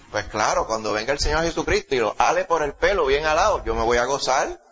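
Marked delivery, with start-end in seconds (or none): none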